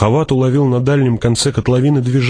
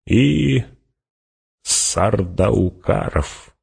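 drawn-out, savoured afterthought with a grin; grinning addition; 3 dB quieter, much slower than usual. A narrator's reading. Fremen adds another enemy to Hawat, grinning and drawn out. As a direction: add it very slowly, with a slight grin. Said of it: "Ухмыльнулся и добавил".

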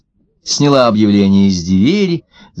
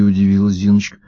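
ordinary delivery, nothing marked

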